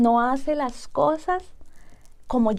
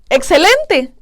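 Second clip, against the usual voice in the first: bright, loud retold "Excelente"; an elated surgeon announcing good news; excited doctor; 4 semitones higher, 6 dB louder, slower than usual. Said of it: "él estaba tan emocionado". (doctor emocionado, más fuerte)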